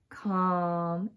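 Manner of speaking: ordinary delivery, nothing marked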